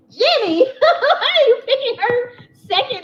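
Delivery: high, loud, slow incredulous classmates, laughing; mock the kids' shock through laughter; laughing mock disbelief. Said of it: "and everybody be like".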